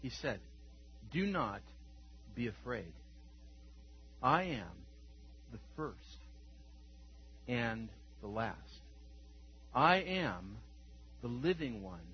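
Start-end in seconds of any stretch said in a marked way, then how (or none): 1.1-12.0 quiet, slow, weighty reading with long pauses; solemn scripture reading. Acted. Preacher reading Christ's words to John, quiet and solemn. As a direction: hold each phrase and let it sit in silence.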